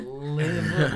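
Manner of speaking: Liverpool accent. Shown as a demonstration. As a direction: put on a strong Liverpool accent. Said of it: "I can sort of just go".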